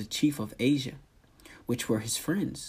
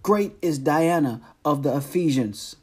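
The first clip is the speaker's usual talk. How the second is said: loud, raised chant-like cry; 4 semitones higher, 7 dB louder than usual. A narrator's reading. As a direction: call it out loud and high as one crowd. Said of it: "cried out".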